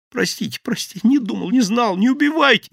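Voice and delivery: high, loud terrified plea; desperate begging. Acted. Thief clutches the boss's legs, begging. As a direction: beg for mercy.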